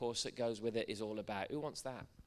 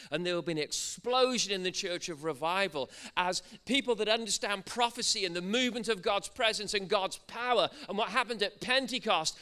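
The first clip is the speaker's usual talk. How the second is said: loud, higher, driving revival preacher; impassioned preaching; 6 semitones higher, 7 dB louder than usual. Real, not acted. Preacher proclaiming a prophecy of revival to church, impassioned preaching. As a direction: build in force and pour it out without letting up.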